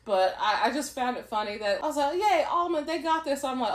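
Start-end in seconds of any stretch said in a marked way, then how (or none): 2.2-3.4 high-pitched little cheer; retold cheer. Viewer happy for a struggling contestant, retold cheer. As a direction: cheer on your favorite, lightly re-enacted.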